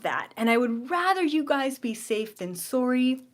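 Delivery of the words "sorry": sorry in a Canadian accent, soor-ee; a light Canadian sorry. Real, not acted. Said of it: "my Canadian accent just came out with the sorry".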